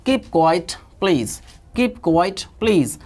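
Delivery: very slow, every word stretched; slow drill. Shown as a demonstration. Teacher modelling a phrase for students, very slow. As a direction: say it slowly so they can copy. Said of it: "revision करते हैं".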